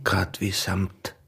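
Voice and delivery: slow, hushed admiration; tender whisper. Acted. The hunter admires her skin in a slow tender hush. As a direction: murmur it like a caress.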